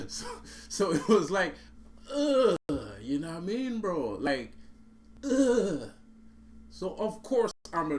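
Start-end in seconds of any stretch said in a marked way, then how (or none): 0.7-2.6 loud exasperated ugh; groan of disgust. Real, not acted. Disgusted at the memory. 5.2-5.9 loud exasperated ugh; groan of disgust. Real, not acted. Disgusted at the memory.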